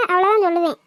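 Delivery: ordinary delivery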